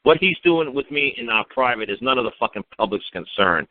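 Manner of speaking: ordinary talk, nothing marked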